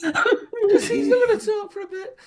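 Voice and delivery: high, slow, through tears of laughter; wheezing laughter while talking; laughing. Real, not acted. Helpless laughter.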